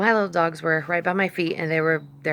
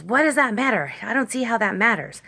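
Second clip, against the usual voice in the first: imitating a testy man, higher and louder; mock angry man; 7 semitones higher, 5 dB louder than usual. Woman imitating the angry dog owner's retort. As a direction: throw back his irritated retort. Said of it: "he goes".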